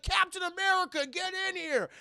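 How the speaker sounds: high, loud urgent call from the truck. Retold or imitated. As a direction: call him in, hammed-up urgency. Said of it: "He's like".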